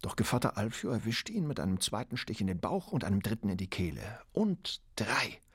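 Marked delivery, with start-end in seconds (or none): 4.3-5.4 cold whisper. Acted. Killer counts final stab.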